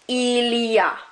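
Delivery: il y a slowly blended into one word; run together. Shown as a demonstration. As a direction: model il y a as one flowing word. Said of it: "runs into one together".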